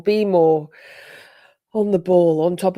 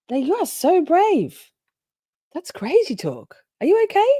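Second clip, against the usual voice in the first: mock shocked concern; 11 semitones higher, 3 dB louder than usual. Real, not acted. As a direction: gasp at the brave chatter, hammed up. Reacting to chat message, mock concern.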